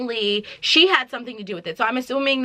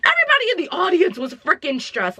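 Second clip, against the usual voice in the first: exasperated; 8 semitones higher than usual. Real, not acted. Exaggerated reaction.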